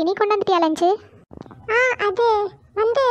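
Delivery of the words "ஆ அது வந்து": high-pitched, hedging stall for words; hesitant. Acted. Poomari put on the spot by friends about lunch, hesitant. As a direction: stall nervously, pitched well up.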